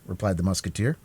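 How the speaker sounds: ordinary delivery, nothing marked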